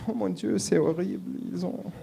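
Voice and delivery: a dismayed Frenchman wailing; French accent. Retold or imitated. French detectives panicking over the theft, French accent. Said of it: "the French are sitting there going".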